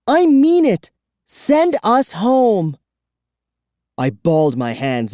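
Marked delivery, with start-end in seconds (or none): 0.1-2.8 high, loud, slow command; furious boy. A narrator's reading. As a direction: demand it fiercely. Boy demands.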